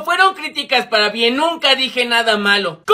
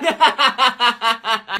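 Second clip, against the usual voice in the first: long run of cackling laughter; mocking laugh; 3 semitones lower than usual. Boy mocks classmate's hair to his friend, long cackle. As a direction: laugh hard at your own jibe. Laughing at own gossip joke.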